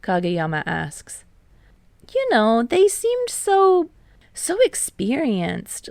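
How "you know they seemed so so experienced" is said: put-on higher, wistful admiration for old seniors; admiring, higher voice. A narrator's reading. Tadashi telling friends about seniors, admiring and higher. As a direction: let the voice lift with admiration.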